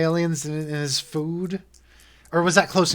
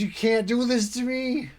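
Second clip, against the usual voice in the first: panicked NPC; 8 semitones higher than usual. Acted. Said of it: "and he says".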